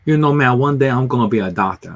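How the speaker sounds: imitated Cajun friend, a few beers in; tipsy Cajun buddy. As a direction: do a buddy's boastful Cajun accent. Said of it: "after several beers".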